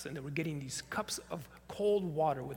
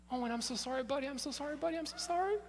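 apologetic; 8 semitones higher, 3 dB quieter, faster than usual. Retold or imitated. Pastor apologizing to a crying child.

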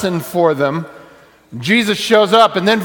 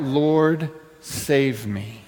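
hushed plea; 4 semitones lower, 7 dB quieter, much slower than usual. Shown as a demonstration. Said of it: "I want you to learn that line".

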